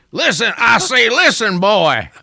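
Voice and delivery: loud, high southern rooster bluster; Foghorn Leghorn style. Pretend. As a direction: boom it out like a cartoon rooster. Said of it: "and Michelle said".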